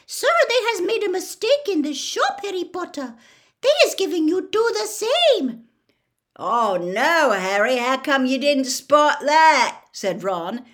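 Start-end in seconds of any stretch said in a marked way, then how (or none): 0.1-5.7 very high, loud squeaky elf, wide-eyed; Dobby. A narrator's reading. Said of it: "his eyes widening". 6.3-9.8 loud, exaggerated teasing exclamation; mock dismay. A narrator's reading. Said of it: "grinning over from his own bed".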